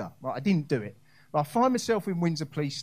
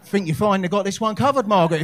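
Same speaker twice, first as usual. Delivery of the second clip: loud sarcastic thanks through a laugh; mock grateful, laughing; 2 semitones higher, 7 dB louder than usual. Sarcastic reply to neighbour.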